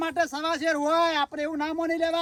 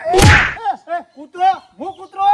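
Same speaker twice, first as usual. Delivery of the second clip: angry yell; 8 dB louder, slower than usual. Acted. Quarrel, insulted as dog.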